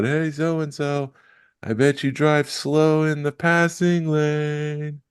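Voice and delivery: high, drawn-out mock holler; heckling yell. Fan joining in the outfield trash talk, drawn-out mock yell. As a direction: call it out long and high, without real volume. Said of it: "I yelled out".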